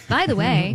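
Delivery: BY THE WAY high and loud with a falling end; over-deliver "by the way" as a final take; belabored take. Shown as a demonstration. Host mocking endless retakes, belabored take. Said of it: "we belabor each take".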